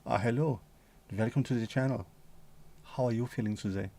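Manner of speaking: put-on soft, hushed creepy German accent; pervy German accent. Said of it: "in a pervy German".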